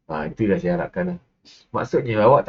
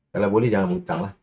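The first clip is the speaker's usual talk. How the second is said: ordinary talk, nothing marked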